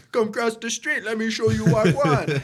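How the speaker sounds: high, loud street-slang voice; hammed-up street-slang invitation; mock street slang. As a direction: beckon like a street hustler. Continuing slang impression.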